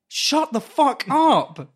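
high, loud, slow snap; exasperated retort. Retold or imitated. Nervous flyer snapping at the wailing woman, high and loud. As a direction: bite it off slowly, fed up. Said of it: "I'm like".

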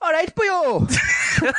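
high, drawn-out sing-song Welsh caricature; slow, hammed-up Welsh accent, rising at the end. Shown as a demonstration. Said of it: "Okay, do Welsh".

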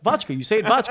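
"Vodka" as VOTCH-kuh, high and firm; insistent mispronunciation. Retold or imitated. Author correcting her colleague, "vodka" as VOTCH-kuh. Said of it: "That's how it's pronounced".

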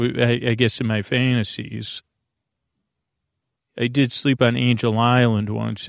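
normal talking voice

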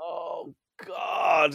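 high, breathy gasp of horror; real horror; host reacting to the coffin story, horrified. Real, not acted. Hearing buried alive story.

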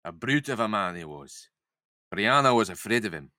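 drifting Scottish-northern Lizzie; vague northern accent. Acted. Lizzie tells Jamie about the man, vague northern accent. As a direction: read it in a roughly faked northern accent. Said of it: "go for a vague northern accent".

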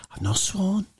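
ordinary talk, nothing marked

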